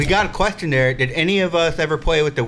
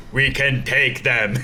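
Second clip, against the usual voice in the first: marauder voice; slower than usual. Retold or imitated. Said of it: "and be like".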